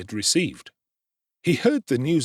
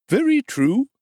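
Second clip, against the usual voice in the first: high, slow, fawning undertaker voice; the obsequious undertaker, pitched up high; high ingratiating tradesman; oily undertaker; 6 dB louder than usual. Acted.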